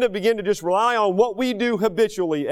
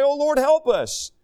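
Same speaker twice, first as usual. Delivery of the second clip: high, drawn-out plea to God; slow mock cry for help, pitched high; crying out in prayer; 8 semitones higher, slower than usual. Congregation praying to God in trouble, pleading cry. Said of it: "we're gonna cry out to God".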